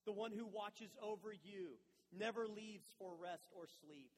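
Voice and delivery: ordinary delivery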